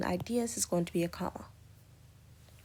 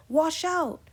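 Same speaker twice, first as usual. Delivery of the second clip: sharp, much higher and louder alarm cry; warning shout; 10 semitones higher, 6 dB louder than usual. Shown as a demonstration. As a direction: yell it to warn someone of danger. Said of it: "Strong emotion of, like, warning someone".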